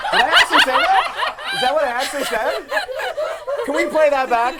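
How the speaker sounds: high, loud, cracking up; can't believe he said it, laughing; laughing in disbelief. Real, not acted. Host laughs at his own misread question.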